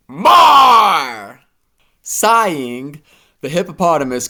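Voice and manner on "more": loud high bark for more; demanding shout. A narrator's reading. Badger to hippo, loud high shout. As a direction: snap for more cash.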